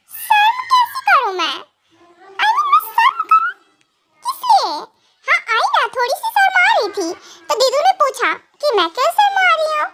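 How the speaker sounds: high, squeaky giggling protest; shy giggly girl. Acted. Girl giggles back at the scolding husband in a high squeaky voice. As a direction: play the coy girl, laughing as she dodges the scolding.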